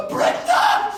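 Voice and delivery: very high, loud startled shout; panicked mom yell. Retold or imitated. Mom to her child at the wheel, panicked yell. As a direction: shriek it like an alarmed driving parent.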